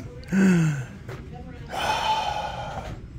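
a breathy high gasp of delight. Real, not acted. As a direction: gasp as something lovely catches your eye. Delighted gasp at shop goods.

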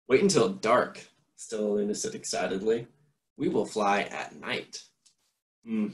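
put-on lively read-aloud voice for children; story narration. A narrator's reading. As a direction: read it like a bedtime picture book. Reads a picture book aloud.

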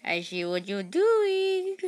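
high, slow taunting villain; menacing villain voice. Retold or imitated. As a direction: play the hunter closing in, slowly.

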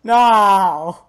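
high, loud cry of protest; loud drawn-out wail; shouted dismay. Real, not acted. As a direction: yell it in dismay. Shouting over a loss in game.

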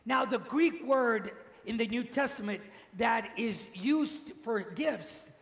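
usual speaking voice

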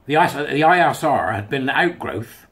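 usual speaking voice

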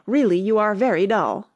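loud, irritated Mock Turtle; angry. A narrator's reading. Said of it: "said the Mock Turtle angrily".

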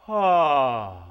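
loud, open ah on the edge of a yawn. Shown as a demonstration. Teacher leading the class in an open-throat ah. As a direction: release a big open ah from the breath. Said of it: "with the edge of a yawn".

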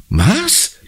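high-pitched shocked exclamation; horrified. A narrator's reading. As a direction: cry it out high, aghast. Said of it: "ruft Conny entsetzt". (entsetzt ausgerufen)

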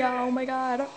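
usual speaking voice

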